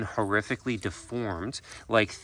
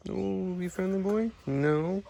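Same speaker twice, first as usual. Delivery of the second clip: coaxing pet voice; 9 semitones higher, slower than usual. Real, not acted. Coaxing the wary parrot to be friendly in high baby talk. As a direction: coo at him to win him over.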